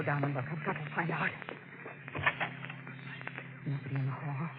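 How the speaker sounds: hushed. Acted. Sneaking past cook, must be quiet.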